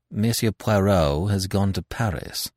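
ordinary delivery

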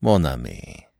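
mild, low-pitched French address; understated calm greeting to a friend. A narrator's reading. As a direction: say it lightly, unruffled. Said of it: "observed the latter mildly".

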